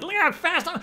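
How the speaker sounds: high, loud, silly show-off voice; mock boasting. Speaker mocking himself showing off on boogie board, high silly voice. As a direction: ham up a proud kid showing off.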